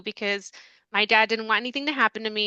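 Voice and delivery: ordinary talk, nothing marked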